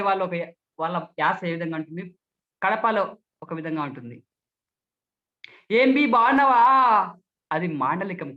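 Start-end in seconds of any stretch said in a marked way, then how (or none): 5.7-7.2 loud, drawn-out Kadapa greeting; Kadapa dialect. Shown as a demonstration. Speech trainer demonstrates regional dialects, Kadapa accent. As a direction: call it out slowly in Kadapa dialect. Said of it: "Kadapa యొక్క మాండలికం".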